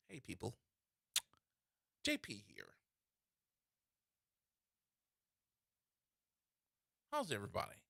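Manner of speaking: low, soft, deadpan vlogger intro; mock video greeting. Comedian mimicking someone's Facebook video, low deadpan greeting. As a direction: greet online viewers low and subdued, halting. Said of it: "then you started making videos".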